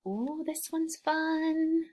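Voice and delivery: mock excitement. Teacher building suspense over a fun word. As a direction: tease the fun word coming.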